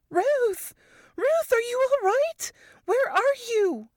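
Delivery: high, frantic calling out; Varys panicked call. A narrator's reading. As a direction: shout her name in fear. Friend calling after fall.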